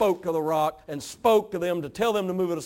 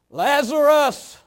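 high, booming, drawn-out summons; loud call; 8 semitones higher, 9 dB louder, much slower than usual. Acted. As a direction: call out loudly to raise the dead. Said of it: "it says with a loud voice".

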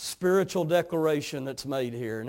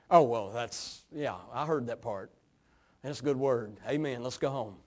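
low, quieter, brushing it off; mock reluctance; 7 semitones lower, 5 dB quieter than usual. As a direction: act out someone mumbling to get away. Acted reluctant hearer brushing off the word.

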